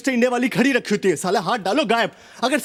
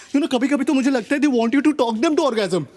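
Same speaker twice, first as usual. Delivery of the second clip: normal talking voice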